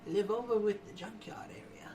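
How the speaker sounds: put-on high, whispery old lady; high, frail granny voice; cute old woman. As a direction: point the way like a dainty granny. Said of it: "all some gingerly and cute".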